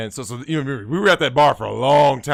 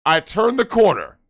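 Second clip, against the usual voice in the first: normal talking voice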